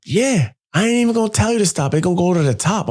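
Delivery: high, cocky brag; cocky boast. Retold or imitated. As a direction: dare him to fill it up. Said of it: "She's like".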